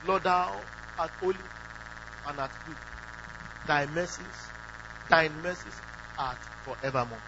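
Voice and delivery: a touch of pompous King James prayer; mock King James prayer. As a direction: intone it like an old formal prayer. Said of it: "Shakespearean English or King James English".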